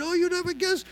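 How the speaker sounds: high, thrilled announcing; gleeful share-the-news call; excited. Retold or imitated. Friend phoning another guy, excitedly. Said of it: "after he got all excited".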